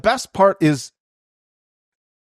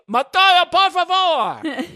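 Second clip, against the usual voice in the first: high, dragged-out imitation of a desperate cry; mock screaming plea; 12 semitones higher, slower than usual. Host chasing him down the beach, imitated pleading scream.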